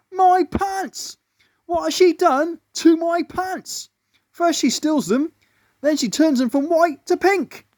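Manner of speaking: a tiny bug gasping at his pants; shocked little ant. A narrator's reading. Ant complaining about pants.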